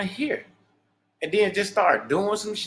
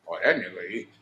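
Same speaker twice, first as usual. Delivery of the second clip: a much lower, mocking put-on voice for other men; mock fake praise; 9 semitones lower than usual. To his brothers, mimicking their fake pride in a low mocking voice. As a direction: drop deep and play the fake-proud guy.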